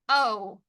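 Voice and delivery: the vowel "O" alone, drawn out; sounded out. Shown as a demonstration. Teacher tapping the second syllable, sounded out. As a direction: isolate the "O" as one sound. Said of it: "Second syllable".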